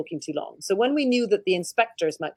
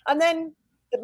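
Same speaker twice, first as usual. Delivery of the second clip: usual speaking voice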